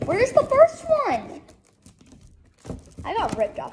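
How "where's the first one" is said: high loud frustrated question; frustrated outcry. Real, not acted. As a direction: demand it, exasperated. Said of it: "I'm so mad".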